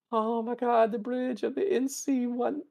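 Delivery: high, breathless fanboy rush; starstruck gasp. Retold or imitated. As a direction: gush like a starstruck cadet. Boimler freaks out on the bridge.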